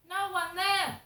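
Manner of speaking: loud, high exclamation of outrage; indignant outburst. Real, not acted. Complaining to viewers about the empty desk, indignant outburst.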